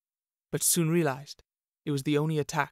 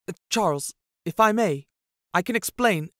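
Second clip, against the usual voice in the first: higher, louder flustered excuses; nervous stammer; 5 semitones higher, 7 dB louder than usual. A narrator's reading. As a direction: scramble to talk your way out. Jack cornered, stammers excuses.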